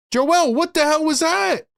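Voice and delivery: real furious shout at Joel; outraged. Real, not acted. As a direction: demand it of him in disbelief. Yelling at Embiid.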